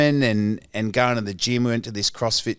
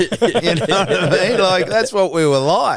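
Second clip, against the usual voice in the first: laughing; 8 semitones higher, 6 dB louder than usual. Real, not acted. Laughing after the war joke.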